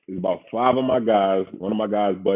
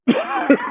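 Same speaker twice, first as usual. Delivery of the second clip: burst of laughter. Real, not acted. Laughing at own lightweight story.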